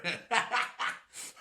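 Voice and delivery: airy high-pitched giggle; silly wheezing laugh; high breathy laugh. Real, not acted. Laughing at own joke.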